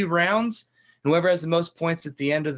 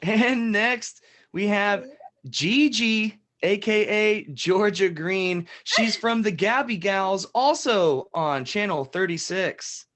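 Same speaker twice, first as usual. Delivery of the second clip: slow, higher game-show announcement; announcer intro; 4 semitones higher, slower than usual. Acted. Host presenting Gigi to the audience, slow showy announcement. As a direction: draw out the introduction with host flair.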